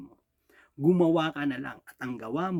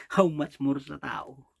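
slow, weighty "How much more sa tao?" with each word drawn out; slow emphatic question; slower than usual. Acted. Preacher presses his flock with a slow, emphatic question. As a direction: lean on "How much more" and let it land slowly.

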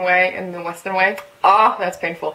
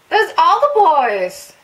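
slow, loud, much higher joyful cry; delighted exclaim; 9 semitones higher, 9 dB louder, much slower than usual. Real, not acted. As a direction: draw it out with joy. Fan sees whole group.

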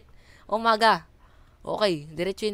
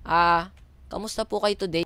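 ordinary talk

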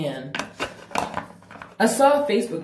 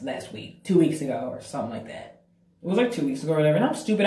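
normal talking voice

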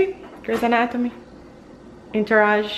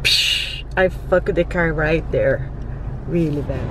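ordinary delivery

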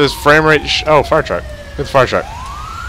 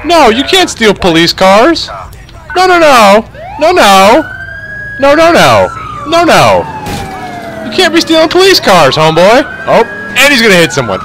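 outraged protest; 10 semitones higher than usual. Real, not acted. Gamer at a thief stealing police cars, outraged.

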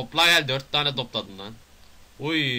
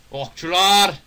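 loud, raised-pitch shout of alarm; shouted call-out; 7 semitones higher, 10 dB louder, slower than usual. Real, not acted. Player spots archers in the game, shouted call-out. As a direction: yell it out like a sudden sighting.

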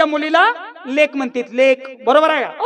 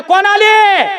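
loud, higher-lifted question to the back rows; louder repeat; 3 semitones higher, 6 dB louder, much slower than usual. Shown as a demonstration. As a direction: shout it so the back answers. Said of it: "मागे आवाज येत नाही". (मागेपर्यंत पोहोचेल असा मोठा आवाज)

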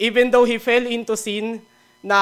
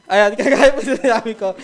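laughing recovery; 3 semitones higher, 7 dB louder than usual. Real, not acted.

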